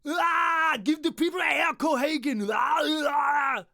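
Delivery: Arnold in Predator. Retold or imitated. The Red Death yelling at the prince like Arnold in Predator. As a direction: scream it, daring him to do it. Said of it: "that speech from Predator".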